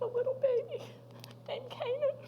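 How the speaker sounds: real choked-up, high voice; voice breaking. Real, not acted. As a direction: let the voice climb into tears. Names the baby who died.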